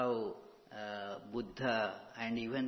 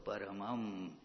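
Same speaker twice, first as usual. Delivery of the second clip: ordinary talk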